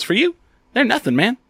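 high, bright-voiced imitation of the old manager; generous showman. Old manager waving off the photo fee, hammed-up generous. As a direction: play it big and kind, like a star to a fan. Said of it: "And he goes".

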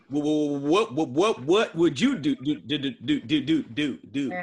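stuck on each word, repeating it over and over; exaggerated stutter. Teammate answering the stutter question in a mock stammer.

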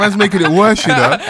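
laughing protest. Real, not acted. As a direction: say it through laughter. Laughing at friend.

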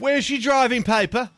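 hammy English cop; British accent. Pretend.

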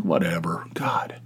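a breathy, unvoiced brush-off; under-the-breath dismissive whisper; whispered whatever. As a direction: whisper it like you can't be bothered. Muttering a dismissive retort.

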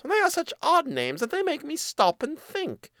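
very high mouse voice; pondering mouse. Acted. Mouse wondering at odd names, very high and puzzled. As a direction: puzzle aloud as a tiny squeaky mouse.

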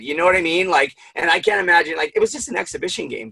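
usual speaking voice

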